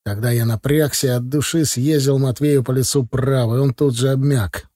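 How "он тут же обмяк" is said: plain reading voice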